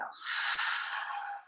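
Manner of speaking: long soft sighing exhale; demonstrated exhale. Shown as a demonstration. Yoga teacher showing the class how to exhale, long soft sigh. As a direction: let the breath out slowly and softly. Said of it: "and then exhale".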